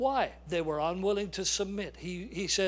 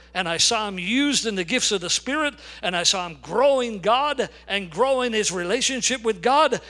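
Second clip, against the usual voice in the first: full-force preaching; 4 dB louder than usual. Acted. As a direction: hammer the story home to the congregation. Preacher builds to climax.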